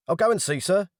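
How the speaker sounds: put-on high, hurried young officer; eager to get out of the room; flustered officer. Flustered officer escaping the angry man, high.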